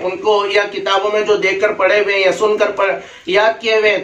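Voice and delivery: ordinary delivery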